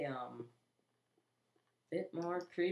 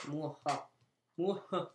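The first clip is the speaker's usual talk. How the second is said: mustache twirling villain; 2 semitones higher, 5 dB louder than usual. Pretend. Gloating after conquering the Nether.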